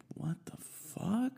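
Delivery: high breathy whisper of disbelief; slow, whispered what the fuck; stunned whisper. Retold or imitated. Amazed friend whispers at the open mic. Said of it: "was just like".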